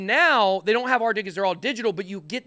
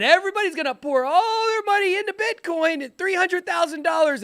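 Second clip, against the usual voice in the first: mock hype; 11 semitones higher, 4 dB louder than usual. Hyped prediction of Bitcoin mania.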